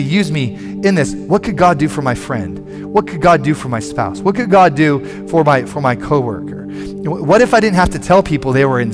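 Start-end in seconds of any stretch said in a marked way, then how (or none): none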